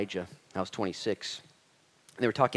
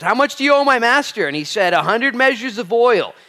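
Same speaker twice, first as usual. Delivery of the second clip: high, loud steward questioning debtor; 13 semitones higher, 12 dB louder than usual. Acted. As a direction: play the shrewd steward. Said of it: "he said to the first".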